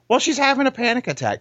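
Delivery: high, mock-fretful imitation; mocking panicky voice. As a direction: pitch it way up like a flustered driver. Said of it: "I was like".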